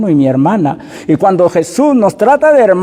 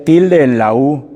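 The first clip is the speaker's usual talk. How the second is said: normal talking voice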